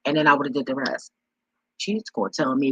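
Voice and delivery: normal talking voice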